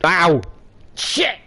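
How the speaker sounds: high yelp after biting tongue; pained yelp. Real, not acted. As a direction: cry out in real pain. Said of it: "I just bit my bloody tongue".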